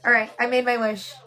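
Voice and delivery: ordinary talk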